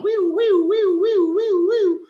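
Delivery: high, loud "wee-wee" siren imitation; alarm imitation. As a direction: mimic an alarm with piercing, repeated high wails. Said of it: "it set off this alarm".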